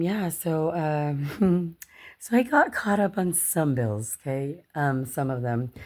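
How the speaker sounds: usual speaking voice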